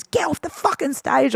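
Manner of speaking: high-pitched angry command; retold furious order, voice pushed up; mock angry order. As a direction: imitate an irate DJ throwing someone off. DJ orders her off in retold story.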